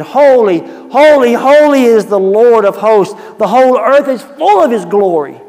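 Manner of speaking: seraph's loud, high holy cry. Acted. Angels crying out before the throne, loud and high. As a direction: call it out like an angel in the temple. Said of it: "one cried to another and said".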